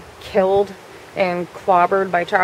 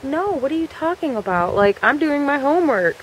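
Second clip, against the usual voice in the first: higher-pitched innocent denial; feigned innocence; 6 semitones higher than usual. Retold or imitated. Culprit lying to Charlie at the door, feigned innocence. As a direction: play dumb in a raised, lighter voice.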